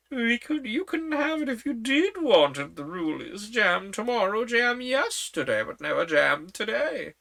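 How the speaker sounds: put-on high, fussy old queen. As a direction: talk up high like a flustered queen.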